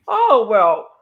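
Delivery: loud, sputtering mock outrage; huffy mock indignation. Mocking the caretaker who scolded her, loud huffy outrage. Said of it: "she starts going off on me".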